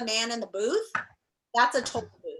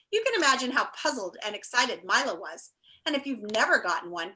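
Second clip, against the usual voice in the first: ordinary delivery, nothing marked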